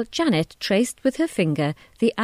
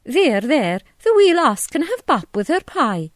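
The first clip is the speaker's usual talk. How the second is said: much higher, louder soothing Scots voice; Scottish landlady; 7 semitones higher, 5 dB louder than usual. A narrator's reading. Tea shop owner soothes disappointed Janet, high Scottish voice. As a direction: comfort the child, broad and bright. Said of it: "Missus MacDonald said".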